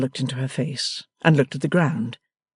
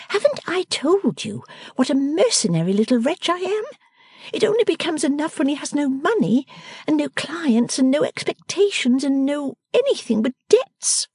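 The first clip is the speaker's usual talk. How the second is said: put-on higher, teasing young woman's voice; playful daughter fussing at her father; Bella, young daughter; 14 semitones higher than usual. A narrator's reading. Daughter Bella teasing her father, higher and playful.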